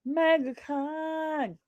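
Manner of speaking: high, slow, hushed MegaCon; dramatic drawn-out announcement. Shown as a demonstration. Streamer teases the next topic, slow and high. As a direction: stretch MegaCon out like a mysterious title.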